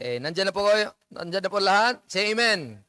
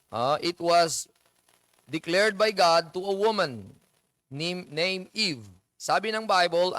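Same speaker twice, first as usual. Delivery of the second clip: normal talking voice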